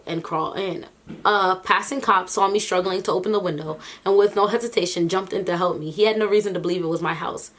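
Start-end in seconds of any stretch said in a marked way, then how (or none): none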